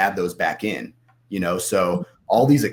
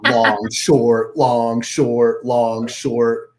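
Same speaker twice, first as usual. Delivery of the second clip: mocking singsong; 3 semitones higher, 6 dB louder, much slower than usual. Mocking his own flip-flopping trades.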